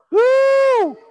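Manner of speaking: high, loud, held "woo"; excited whoop. Real, not acted. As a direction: let out a jubilant high whoop. Preacher whoops after the verse.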